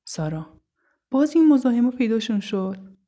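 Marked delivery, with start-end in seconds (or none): 1.1-3.0 high, loud exasperated complaint; annoyed outburst at pests turning up. Acted. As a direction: complain out loud, thoroughly fed up. Sara complains as harassers appear.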